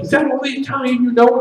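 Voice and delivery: high-pitched acted comeback; incredulous retort. Acted quote of the argument.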